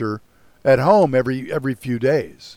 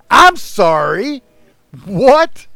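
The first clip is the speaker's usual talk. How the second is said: high, loud, slow disbelief; baffled what; 10 semitones higher, 10 dB louder, much slower than usual. Retold or imitated. Retelling how he answered the old woman, loud and stunned. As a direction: stretch out the confused sorry. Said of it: "And I went".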